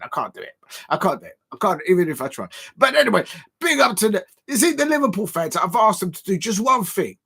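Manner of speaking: ordinary talk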